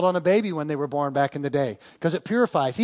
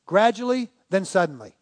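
slow quoted answer. Retold or imitated. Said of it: "He said".

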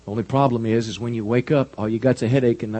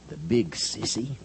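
shaky voice; 3 semitones lower, 9 dB quieter, slower than usual. Acted. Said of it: "by a shaky voice".

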